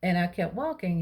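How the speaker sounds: ordinary talk, nothing marked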